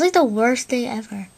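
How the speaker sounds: ordinary talk, nothing marked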